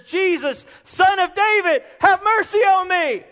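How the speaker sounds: loud, high plea for mercy; preacher voicing the blind beggar's cry. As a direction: call out desperately to Jesus. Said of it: "he begins to cry out".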